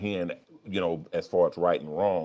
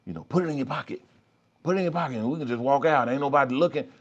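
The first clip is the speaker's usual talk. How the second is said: tempting inner voice; 5 semitones higher, faster than usual. Pretend. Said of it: "that little voice in your head says".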